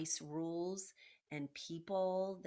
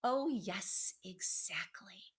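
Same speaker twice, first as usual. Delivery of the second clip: sly whisper; slower than usual. Acted. Rat gloating to the captured mouse, a slow whisper. Rat savouring Despero's fear.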